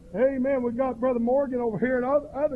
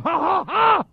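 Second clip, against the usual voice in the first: high, shouted ha-ha-ha; mimicked pulpit outburst, loud and high; imitated preaching "ha"; 8 semitones higher, 7 dB louder, slower than usual. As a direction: rear back and punch out each ha. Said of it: "reared back and started going".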